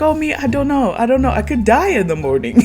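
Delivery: high-pitched retold fretting; mock over-literal worrying. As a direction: fret it out high, imitating him. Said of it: "Florian is taking it so literal".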